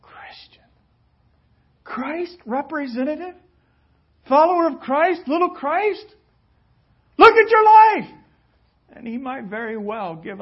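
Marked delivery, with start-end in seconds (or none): 0.0-0.6 a timid whisper. Pretend. Meek answer to Paul. 1.8-8.1 loud, rising, incredulous scolding; mocking rebuke. Paul imagined scolding believers.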